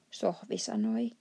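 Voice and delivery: plain reading voice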